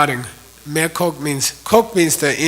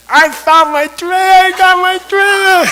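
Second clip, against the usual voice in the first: much higher, louder, slowed exultant cry; overjoyed; 6 dB louder than usual. Retold or imitated. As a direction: shout it out, beside himself with joy. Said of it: "he's so happy".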